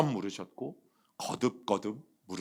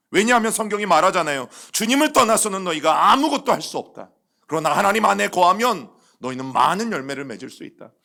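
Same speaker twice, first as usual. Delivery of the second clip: louder, rising sermon at full force; fervent preaching; 5 semitones higher, 8 dB louder than usual. Acted. Pastor urging the congregation about fruit from the Lord, fervent preaching. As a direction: drive the point home to the congregation.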